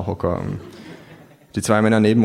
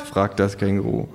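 ordinary delivery, nothing marked

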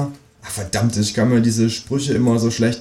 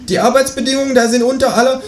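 much higher cheeky kangaroo voice; 3 dB louder than usual. Acted. Kangaroo asking the narrator for eggs, much higher voice. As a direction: play the new neighbour kangaroo, pitched far up. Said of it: "ruft mir das Känguru hinterher".

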